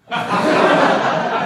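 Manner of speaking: sharp high laugh; loud laughing burst. Real, not acted. Speaker to the audience laughing loudly. Laughing at his own story.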